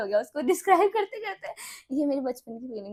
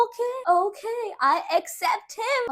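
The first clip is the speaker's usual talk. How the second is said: mock gracious acceptance; 9 semitones higher, 4 dB louder, much slower than usual. Child accepting new deskmate.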